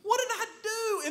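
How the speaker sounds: high bewildered "What did I do?"; mock-baffled husband; clueless husband. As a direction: squeak it out like a lost husband. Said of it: "and I'm like".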